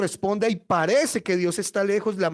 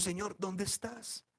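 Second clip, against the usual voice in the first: very soft, slow plea; slow, near-whispered searching prayer; hushed prayer; 14 dB quieter, slower than usual. Acted. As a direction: ask God quietly, as if forsaken. Desperate prayer to a silent God.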